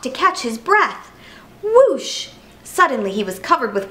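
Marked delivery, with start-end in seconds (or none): 1.6-2.3 high, sudden spoken whoosh; storybook sound effect, pitched way up. A narrator's reading. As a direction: imitate a burst of spraying water. Storybook noise of water spray.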